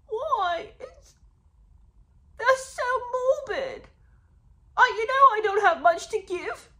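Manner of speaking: high, loud, tearful mock outburst; whiny mock wailing. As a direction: wail it like an overwrought, offended crybaby. Acts out whiny devastated reaction.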